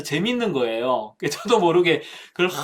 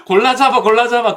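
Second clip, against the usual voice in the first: louder, higher vendor cry recalled; hawker's shout as heard by a scared child; vendor call; 6 semitones higher, 6 dB louder than usual. Retold or imitated. Preacher recalling the vendor's call, louder and higher. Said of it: "그게 막".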